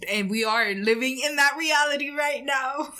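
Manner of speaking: cracking up at the irony; words spilling out through laughter; laughing. Real, not acted.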